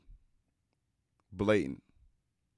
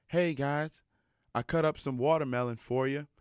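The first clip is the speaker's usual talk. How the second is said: higher, a lightly put-on Mississippi accent; imitating Mississippi accent; 5 semitones higher than usual. Coach calls the players for watermelon, Mississippi accent. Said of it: "he's from Mississippi, so he's got this accent".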